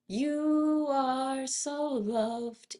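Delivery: high, very slow lifted "You are so loved"; spacious lifted voice. Shown as a demonstration. Teacher showing yawn-lifted resonance, spacious voice. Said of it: "that more spaciousness sound".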